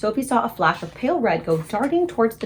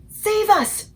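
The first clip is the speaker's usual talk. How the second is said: high, loud terrified scream; 12 semitones higher, 5 dB louder than usual. A narrator's reading. Giant shrieking in his nightmare, high terrified scream. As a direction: shriek for rescue. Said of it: "screamed the flesh lump eater".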